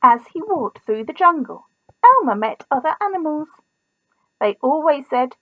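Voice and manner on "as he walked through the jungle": ordinary delivery, nothing marked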